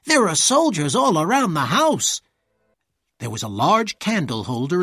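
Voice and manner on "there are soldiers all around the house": loud, high urgent warning; breathless servant raising the alarm, loud and high; alarmed cry. A narrator's reading. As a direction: shout the news in alarm. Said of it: "he cried".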